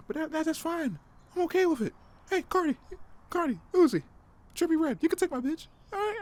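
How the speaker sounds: very high, mock-easygoing squeal; exaggerated falsetto reassurance; high-pitched protest. Mock-conceding to the rappers, voice shoots up.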